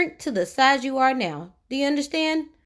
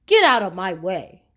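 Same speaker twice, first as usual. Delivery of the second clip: a louder rude snap; 3 semitones lower, 4 dB louder than usual. Acted. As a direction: snap at the little boy. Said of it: "snapped Mister Uppity rudely".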